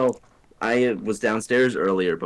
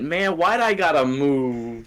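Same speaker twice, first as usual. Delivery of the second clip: higher drawn-out whine of self-pity; mock lament; 5 semitones higher, slower than usual. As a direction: whine it slowly, dragging the words out. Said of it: "then my brain went".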